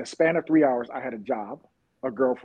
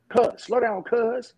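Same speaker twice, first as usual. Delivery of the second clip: higher-pitched street guy calling after someone; stranger calling out; 9 semitones higher, much slower than usual. Retold or imitated. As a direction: mimic a man hailing a runner. Retold stranger calling after him.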